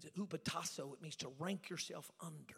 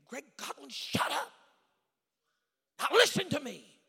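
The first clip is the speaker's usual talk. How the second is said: high imitated scream, growing much louder; 9 semitones higher, 13 dB louder than usual. Mentor demanding to be heard, imitated scream. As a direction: burst into a scolding yell. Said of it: "he screamed at me".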